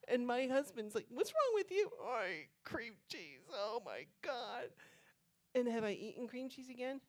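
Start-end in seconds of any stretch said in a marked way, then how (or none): 1.1-1.9 husband's alarmed question. Retold or imitated. Husband reacts to her moaning. 2.0-4.7 pained slow groan. Retold or imitated. Groaning to husband in pain.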